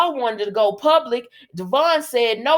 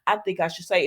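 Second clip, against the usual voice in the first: ordinary talk, nothing marked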